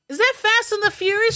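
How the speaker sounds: very high imitation of a chatty woman; blurted question. Patient talking over the movie, high blurted imitation. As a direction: pipe up high over everyone.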